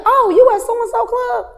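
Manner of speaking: high, loud imitated greeting; hammed-up surprised hello; excited greeting. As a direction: call it out over club noise.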